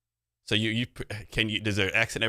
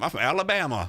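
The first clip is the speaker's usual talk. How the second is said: put-on Southern accent; 7 semitones higher than usual. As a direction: imitate a young Southern woman's thick accent. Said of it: "thick ass accent".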